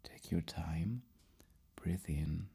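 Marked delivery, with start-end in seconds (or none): none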